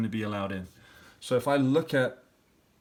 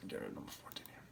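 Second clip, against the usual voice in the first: whispered aside; 18 dB quieter, much faster than usual. Real, not acted. Muttered aside while editing.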